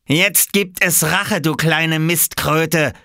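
slow, high, menacing declaration; threatening. A narrator's reading. Big boy dragging the culprit to the lake, slow threat. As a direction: draw out the threat with relish. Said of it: "drohte er".

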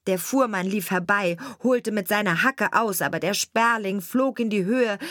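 ordinary delivery

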